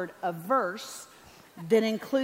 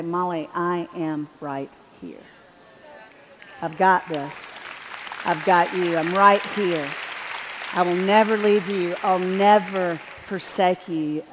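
God's reassurance; 3 semitones lower, 6 dB louder than usual. Retold or imitated. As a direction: declare each promise with weight. God's voice to Molly retold.

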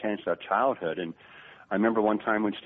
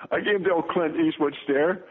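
ordinary talk, nothing marked

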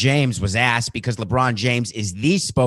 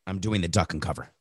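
normal talking voice

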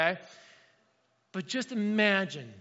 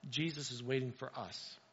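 ordinary talk

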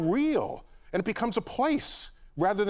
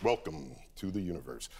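deep, hushed sonorous narration; low velvety star show welcome; planetarium director voice; 8 dB quieter than usual. Shown as a demonstration. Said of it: "in my planetarium director voice".

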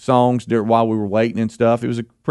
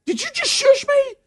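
incredulous. Retold or imitated. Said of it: "And I said".

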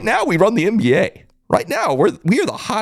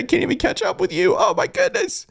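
very high, giddy disbelief; high-pitched amazed fan; overwhelmed excitement; 12 semitones higher, 3 dB quieter than usual. Real, not acted. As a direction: let the voice climb way up, overwhelmed.

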